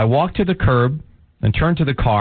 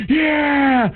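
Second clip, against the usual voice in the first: high, drawn-out "yeah" of a concert crowd; crowd cheer. Acted. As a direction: imitate a crowd cheering back, without real volume. Acted concert crowd cheering back.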